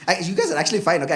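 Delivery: usual speaking voice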